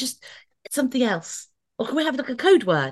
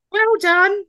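mock well done; 10 semitones higher, 4 dB louder than usual. Mocking English encouragement.

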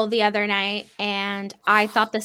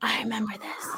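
hushed whisper, barely voiced; 10 dB quieter than usual. Real, not acted. Remembering the clip, said in a whisper. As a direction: breathe it out as a hushed aside. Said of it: "whispered recall".

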